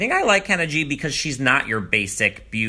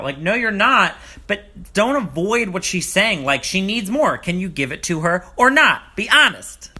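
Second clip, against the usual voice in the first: scolding; 7 semitones higher, 4 dB louder than usual. Real, not acted. Scolding Colton on TV.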